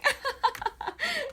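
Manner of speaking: high giggling laugh; bright high laugh; high giggle. Real, not acted. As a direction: giggle at your own joke. Laughing at own joke.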